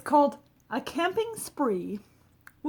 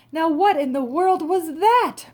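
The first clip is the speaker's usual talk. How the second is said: louder, higher, alarmed cry; startled exclamation; 7 semitones higher, 9 dB louder than usual. Acted. McGee woken by the splash, startled and loud. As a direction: jolt awake and demand what happened. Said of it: "McGee said".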